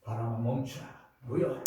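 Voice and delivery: low, firm command to the wind; a hint of Jesus rebuking the storm; commanding the sea. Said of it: "이렇게 말씀하셨어요".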